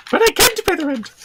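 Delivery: high squeaky distressed woman; shrill panto damsel. Pretend. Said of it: "poor woman in distress".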